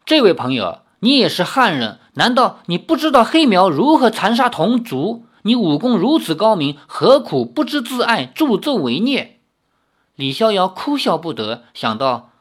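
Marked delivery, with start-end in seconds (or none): 0.0-9.4 提高音量，语重心长地高声劝诫; 像隔着阵势喊话劝对方回头; 大声劝说. A narrator's reading. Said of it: "那队长大声说". (队长打不过，大声劝李逍遥别助纣为虐)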